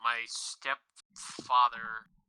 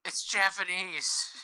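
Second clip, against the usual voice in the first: slow deadpan correction; 7 semitones higher, much slower than usual. Retold or imitated. Retold reply to coworker.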